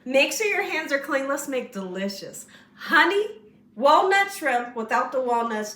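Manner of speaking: ordinary talk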